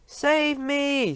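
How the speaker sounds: high terrified giant scream; a giant screaming in his sleep. A narrator's reading. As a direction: shriek for help. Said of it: "screamed the flesh lump eater".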